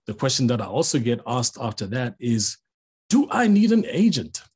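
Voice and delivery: normal talking voice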